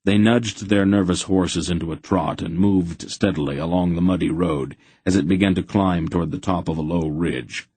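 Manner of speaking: usual speaking voice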